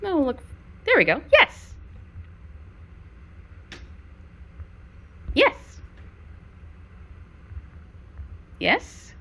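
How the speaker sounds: loud high dog praise; excited praise. Real, not acted. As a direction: cheer the dog on.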